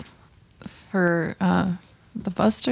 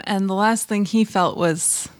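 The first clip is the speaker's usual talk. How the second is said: usual speaking voice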